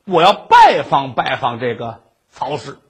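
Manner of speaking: usual speaking voice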